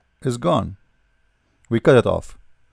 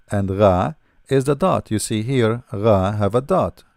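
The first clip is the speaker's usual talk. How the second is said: usual speaking voice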